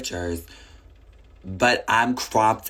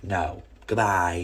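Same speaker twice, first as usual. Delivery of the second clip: ordinary talk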